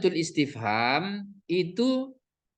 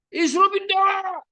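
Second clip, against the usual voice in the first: harsh wrong reading; 5 dB louder than usual. Shown as a demonstration. Teacher mocks a father advising his sick child, shouted high. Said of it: "Kan nggak mungkin begitu".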